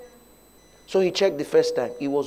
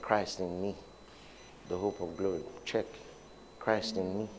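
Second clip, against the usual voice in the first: much softer, lower murmured prayer; speaking inside; 5 semitones lower, 9 dB quieter than usual. Retold or imitated. Preacher retelling his silent confession at the clinic, very softly. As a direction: say it under your breath to yourself. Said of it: "I began to speak inside".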